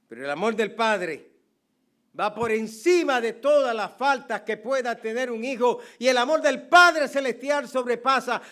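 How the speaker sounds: loud, high, fervent pulpit delivery; impassioned sermon climax; full-force preaching. Acted. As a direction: preach it out with rising passion. Preaches the Father's welcome.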